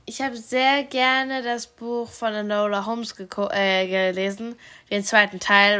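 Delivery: ordinary talk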